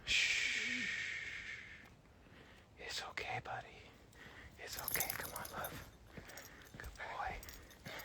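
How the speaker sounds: hushed, breathy comfort, no voice; soothing whisper. Real, not acted. Soothing her little one mid-video in a whisper. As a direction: whisper softly to settle him down.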